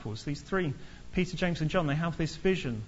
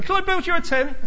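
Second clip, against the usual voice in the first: high, loud mock gushing; eager Peter butting in; 13 semitones higher, 10 dB louder than usual. Peter butting in on the mountain, high and gushing. Peter's tent offer voiced again.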